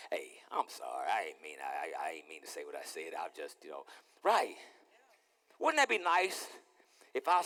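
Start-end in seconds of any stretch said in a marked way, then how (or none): 0.1-3.8 soft, hushed sorry; whispered apology. Acted. Husband whispering a sheepish apology to his wife.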